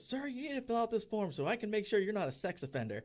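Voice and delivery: imitating her, high and laughing through it; mock-indignant demand, half laughing; exasperated woman. Woman chasing a walking-away dad over the form, high mock indignation. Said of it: "now this woman was heated".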